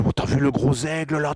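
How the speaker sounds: high and loud, pointing at the sky in wonder; excited shout. Acted. Belgian hunter to his friend, excited high shout. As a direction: yell it out amazed at the big bird.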